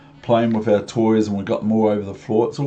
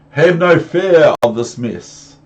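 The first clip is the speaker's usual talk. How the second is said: louder, slow, reassuring boast; confident cat; 5 semitones higher, 7 dB louder than usual. Acted. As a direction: announce it grandly. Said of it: "said the Cat in the Hat".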